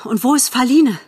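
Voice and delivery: high, urgent question; agitated, impatient. A narrator's reading. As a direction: demand an answer impatiently. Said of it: "Bambi sprach schnell, aufgeregt und ungeduldig". (aufgeregt und ungeduldig)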